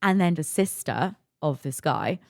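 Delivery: ordinary delivery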